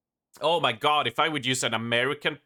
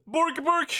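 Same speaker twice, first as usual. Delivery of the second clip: much higher mock-Swedish yelp; Swedish Chef. Streamer making fun of Scandies, high mock-Swedish chef. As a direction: squawk it in cartoon Swedish gibberish. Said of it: "cancel Swedish Chef".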